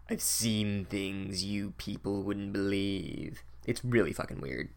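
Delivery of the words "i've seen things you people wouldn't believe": slow, much softer, lower movie villain voice; hushed, slow sci-fi villain monologue; Blade Runner villain. Retold or imitated. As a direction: drag it out quietly like a replicant's last speech. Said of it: "sounds like the bad guy from Blade Runner".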